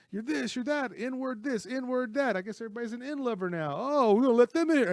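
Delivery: loud, high-pitched mock tirade; high, jeering imitation of a ranting bigot; retold heckling rant. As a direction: go off like a heckler hurling abuse. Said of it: "just goes off saying all these bad things".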